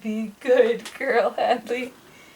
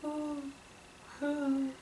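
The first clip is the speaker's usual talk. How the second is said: soft, higher, held hoots; owl hoot; 4 semitones higher, 15 dB quieter than usual. Shown as a demonstration. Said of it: "How about the owl?".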